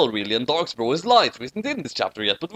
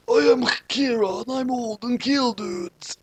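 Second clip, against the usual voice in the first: Kira; 9 semitones higher than usual. Acted. Kira voiced in story.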